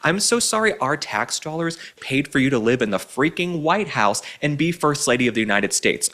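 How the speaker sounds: ordinary talk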